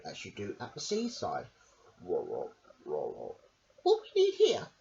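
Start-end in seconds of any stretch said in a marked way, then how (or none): 2.0-3.4 much lower, growly rumbling sound; tummy rumble. Acted. Storyteller makes a hungry stomach grumble for the kids. 3.8-4.7 much higher, squeaky little lady voice; Missus Armitage voice. Acted. Missus Armitage asks her dog a question, high and squeaky. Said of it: "said Missus Armitage".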